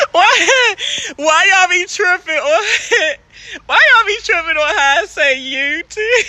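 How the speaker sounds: high-pitched, giggling through the words; laughing protest. Real, not acted. Streamer teased about how she says you, laughing protest. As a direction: protest while cracking up.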